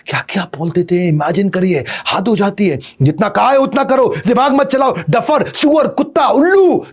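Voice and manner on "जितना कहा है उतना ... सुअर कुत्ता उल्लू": higher and higher, building to a shrieking string of insults; angry parent scolding. Retold or imitated. Parent berating a child, shouting names in rising fury. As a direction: spit out each insult, climbing in pitch.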